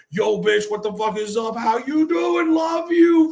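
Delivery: high, loud greeting that sounds like a fight; shouted affectionate greeting; loud Italian greeting. Retold or imitated. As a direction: bellow the hello like a domestic. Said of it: "would literally sound like a fucking domestic".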